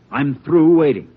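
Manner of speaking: impatient angry demand; angry outburst. Acted. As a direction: snap it out, done waiting. Hostage taker losing patience.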